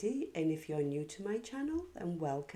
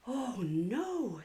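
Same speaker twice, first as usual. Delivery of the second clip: higher, worried little gasp of dismay; a child's higher, hushed oh no; 6 semitones higher than usual. Acted. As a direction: Katie realising she made a mess. Katie spills the vase.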